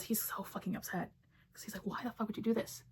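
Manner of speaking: hushed whisper. Retold or imitated. Andrew upset, hushed.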